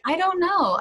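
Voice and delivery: caught-off-guard high blurt; startled blurt. Retold or imitated. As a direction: blurt it out on a jump in pitch. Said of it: "I said it absentmindedly".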